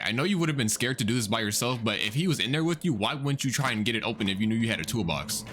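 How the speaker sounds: normal talking voice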